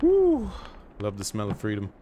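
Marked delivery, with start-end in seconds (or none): 0.0-0.5 excited cheer. Real, not acted. Cheer after artillery hits.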